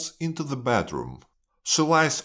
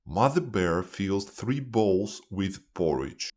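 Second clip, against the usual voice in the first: usual speaking voice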